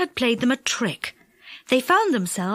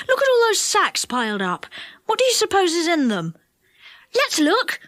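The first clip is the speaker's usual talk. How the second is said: put-on higher, eager child's wondering; excited curiosity; 6 semitones higher than usual. A narrator's reading. Children find piled-up sacks, excitedly curious. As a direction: marvel and wonder what's inside.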